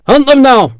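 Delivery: loud, high rallying call; called order. A narrator's reading. Officer sending his sepoys after the garrison, loud call. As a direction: urge the men on. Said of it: "the tall officer called".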